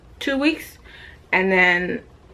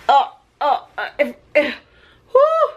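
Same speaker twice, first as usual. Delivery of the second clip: laughing struggle; 5 semitones higher than usual. Real, not acted.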